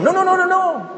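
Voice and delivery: high-pitched mock protest; rapid string of imitated objections; objecting theologians. Theologians objecting to MacArthur's book, in high mock protest. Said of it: "Y unos teólogos dijeron".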